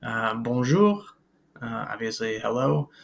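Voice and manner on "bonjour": bonjour drawn out slowly with a rising end; slow French bonjour. Shown as a demonstration. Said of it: "some greetings here".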